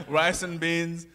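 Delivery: chuckling through the words, slowed; laughing. Real, not acted. Laughing as he tells the interviewer about rice and beans. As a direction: laugh it out at the plain answer. Laughing over simple food answer.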